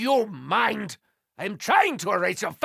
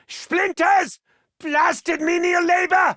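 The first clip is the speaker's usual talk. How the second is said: angry outburst; 8 semitones higher than usual. Acted. Angry at building with planks.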